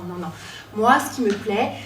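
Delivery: usual speaking voice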